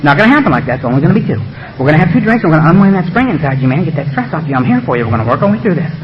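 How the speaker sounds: low-pitched conspiratorial reassurance; coaxing beast. Acted. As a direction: talk them gently into a secret. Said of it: "the beast whispers up and say".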